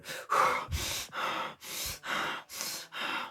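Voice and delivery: mimicked seething breaths, long and noisy; acted-out angry huffing; imitated heavy breathing. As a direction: huff hard in and out, imitating someone about to blow. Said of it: "she's sitting there going".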